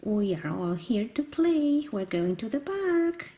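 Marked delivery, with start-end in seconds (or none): none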